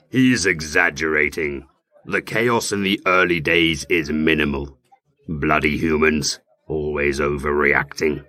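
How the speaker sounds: low, slow demonic growl. Acted. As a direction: speak as the demon inside him. Demon interrupts, low far voice.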